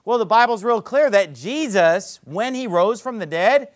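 normal talking voice

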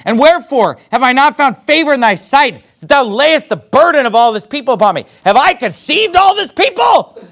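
high, impassioned protest to God; upset, angry yelling. Acted. Moses protests to the Lord about his people, upset and angry. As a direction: read it as an exasperated outcry, pitch climbing. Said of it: "yelling at God about it".

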